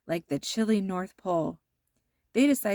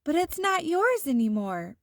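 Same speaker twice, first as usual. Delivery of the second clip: protesting; 7 semitones higher than usual. A narrator's reading. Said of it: "protested Karen".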